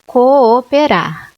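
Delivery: cooperar drawn out slowly with both Os apart; slow syllables. Shown as a demonstration. Teacher sounding out the hiatus for students. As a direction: stretch cooperar to show the hiatus. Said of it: "existe um hiato aí".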